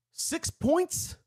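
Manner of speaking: high, rising disbelief; shocked high question; incredulous question. Real, not acted. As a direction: ask it like you can't believe the number. Incredulous repeat of the score.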